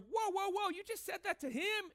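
can't believe what he just said; shocked bystander. Acted. Bystanders shocked at Paul's insult.

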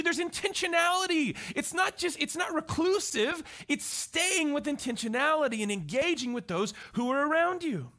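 ordinary delivery

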